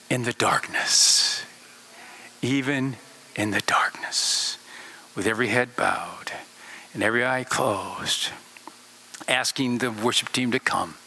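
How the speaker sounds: low, near-whispered altar call; intimate, hushed closing invitation; hushed prayer. Real, not acted. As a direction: bring it right down, soft and low with long pauses.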